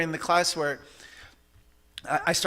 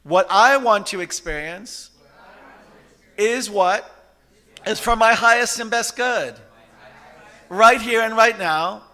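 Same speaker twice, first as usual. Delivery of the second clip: proclaimed affirmation; 3 semitones higher, 7 dB louder than usual. Shown as a demonstration. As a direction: declare it to the whole room, phrase by phrase. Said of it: "let's say that out loud as an affirmation".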